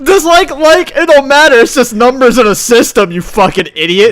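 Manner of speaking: loud, high, mocking; mock hater rant. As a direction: sneer at the commenters.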